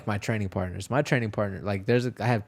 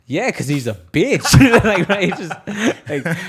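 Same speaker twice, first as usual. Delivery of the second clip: can't keep a straight face; laughing while quoting the trash talk; 8 semitones higher, 10 dB louder than usual. Retold or imitated.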